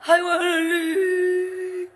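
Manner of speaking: mother cries out in heavy dialect; mother's dialect wail. Retold or imitated. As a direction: draw it out like a grieving old mother. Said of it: "처음 사통을 깼다니까".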